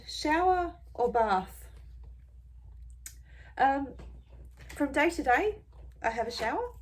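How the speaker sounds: ordinary talk, nothing marked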